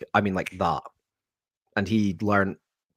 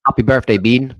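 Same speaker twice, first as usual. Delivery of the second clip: loud birthday shout; 4 semitones higher, 9 dB louder than usual. Real, not acted. Friends calling out birthday wishes.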